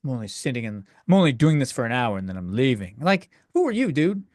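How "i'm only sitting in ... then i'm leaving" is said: a lightly voiced, uninterested star at a convention; mimicking a jaded horror voice actor; mocking celebrity. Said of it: "the guy that did the voice from Scream's like".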